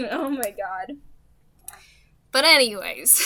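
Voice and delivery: ordinary delivery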